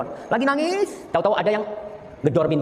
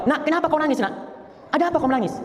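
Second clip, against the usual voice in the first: mother's voice raised high, worried; a hint of an anxious mother pitched up high; 11 semitones higher than usual. Mother asking her crying daughter why, high and worried.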